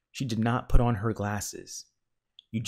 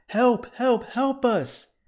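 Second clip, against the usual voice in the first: high, loud repeated cries for help; urgent storybook yells; distant shout; 14 semitones higher, 7 dB louder, much slower than usual. A narrator's reading. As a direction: call for rescue as if from far away. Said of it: "shouted a faraway voice".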